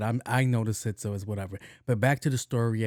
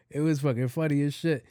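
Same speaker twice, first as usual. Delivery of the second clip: ordinary talk, nothing marked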